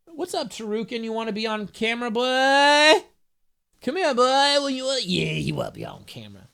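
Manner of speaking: high cooing voice to the cat; pet talk. Real, not acted. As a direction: coax the cat onto camera. Talking to her cat.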